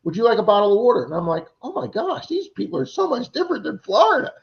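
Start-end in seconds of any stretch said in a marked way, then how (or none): 0.0-1.0 much higher and louder, eager hospitality; sweet friendly neighbour. Retold or imitated. Missouri homeowner offers the digger water, high and sweet. 1.6-4.3 far higher, breathless amazement; amazed inner thought. Retold or imitated. Digger marvels at Missouri kindness, much higher.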